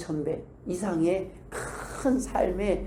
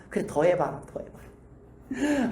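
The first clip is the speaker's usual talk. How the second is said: weary voice of God; 5 dB quieter, much slower than usual. Acted. God listening to complaints.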